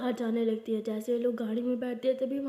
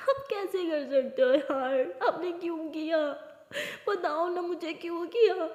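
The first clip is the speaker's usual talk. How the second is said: slow tearful plea; crying; 5 semitones higher, slower than usual. Acted. Aliya crying at her uncle, pleading why he did it. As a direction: beg for an answer through tears. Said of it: "Alia ऐसे बहुत रोने लगती है".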